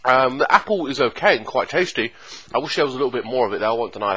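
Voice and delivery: eating pastry while speaking; talking while chewing. Real, not acted. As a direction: mouth partly full. Seen 0: Holds bitten pastry throughout, lips working as if chewing mid-speech.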